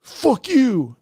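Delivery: high, loud fake outburst; hammed-up fuck you; mock angry retort. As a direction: snap it back like a stubborn friend. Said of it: "And you're like".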